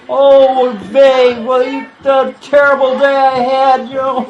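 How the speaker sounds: loud, high mock moan that turns into a complaint; fake moan complaint. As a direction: ham up the wife's whining "moan". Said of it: "And she says".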